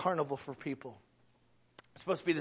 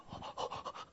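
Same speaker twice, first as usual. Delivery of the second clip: breathy, exaggerated gasp; hushed intake of horror; mock shocked gasp; 11 dB quieter than usual. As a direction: gasp like a scandalized crowd. Imitates everyone's shocked reaction.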